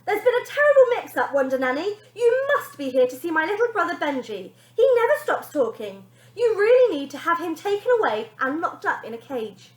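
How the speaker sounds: put-on high, loud wheedling girl; bratty girl pleading. Girl trying to shift blame onto her brother, high and loud. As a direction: talk your way out of it, pushy.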